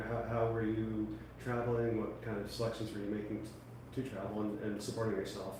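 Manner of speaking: ordinary delivery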